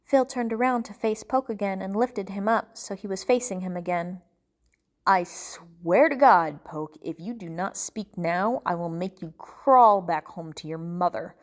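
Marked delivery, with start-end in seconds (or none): 5.1-11.4 slow, drawn-out threat; deliberate, controlled menace; menacing threat. Acted. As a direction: swear it slowly, leaning hard on key words. Captor threatens the captive.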